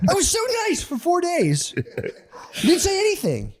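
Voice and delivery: high, squeaky mock meltdown, laughing; spazzing at wife. Husband snapping at his wife after four nice days, shrill mock whine. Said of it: "I'll spaz and be like".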